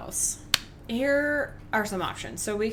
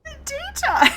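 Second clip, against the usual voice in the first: much higher, louder squeal; high shriek; 8 dB louder than usual. Real, not acted. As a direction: squeal it out, giddy. Shrieking at the clip.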